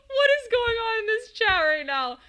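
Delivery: high, loud wailing outburst; exasperated wail. Real, not acted. Streamer overwhelmed by chat, loud exasperated wail. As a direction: wail it at an unruly crowd.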